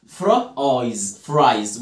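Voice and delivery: "فرا آيز" slowly in parts, then joined as "فرايز"; split, then blended. Shown as a demonstration. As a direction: sound out fra, eyes, then blend to "فرايز". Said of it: "لونج آي".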